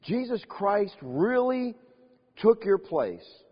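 very slow, each word weighed; slow solemn emphasis. Acted. Preacher telling the church Christ took their place, slow and weighty. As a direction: lay it down slowly so it sinks in.

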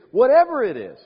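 whatever it is rings out high and loud; preaching shout. Acted. Preacher crying out to the congregation, loud and high. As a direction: hit the top of the list with full force. Preacher builds list to climax.

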